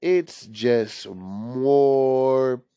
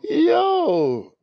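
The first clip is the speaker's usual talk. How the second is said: high laughing yelp. Real, not acted. Laughing at own story.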